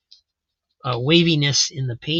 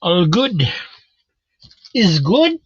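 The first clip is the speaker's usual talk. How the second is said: put-on lazy Hungarian accent; 3 semitones higher, 9 dB louder than usual. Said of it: "says the lazy Hungarian".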